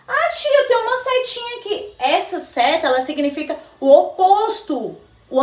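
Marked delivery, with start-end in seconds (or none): 0.1-1.9 a lightly voiced eager pupil, higher; child voice. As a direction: play the curious pupil calling the teacher. (aluno curioso chamando a tia, voz mais aguda)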